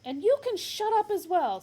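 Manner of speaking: loud, high snap; snapped. Acted. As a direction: snap at the guard. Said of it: "snapped Ford".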